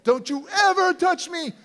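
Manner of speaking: loud, high furious warning that builds; escalating mother's yell; screaming mom. Retold or imitated. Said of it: "screaming at me".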